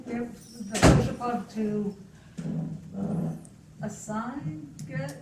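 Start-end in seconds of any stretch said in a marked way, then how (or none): none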